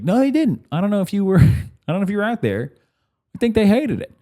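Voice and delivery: high-pitched exasperated contradiction; incredulous protest. Retold or imitated. Acted argument with the casino guy.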